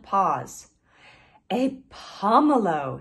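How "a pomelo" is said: slow, drawn-out delighted cry; delighted exclaim. A narrator's reading. Pangolin finding a fruit on his head, excited. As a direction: savour the discovery aloud. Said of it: "he exclaimed".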